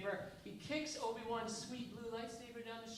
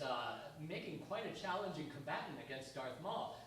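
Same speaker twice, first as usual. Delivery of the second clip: usual speaking voice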